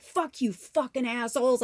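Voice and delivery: mock yell of rage, somewhat held back; retold screaming. Cursing at spirit in general, retold angry yelling. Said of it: "screaming at spirit in general".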